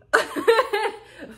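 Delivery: high bright burst of laughter. Real, not acted. Laughing at her own quote, a high burst of laughter. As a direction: laugh out loud at the joke.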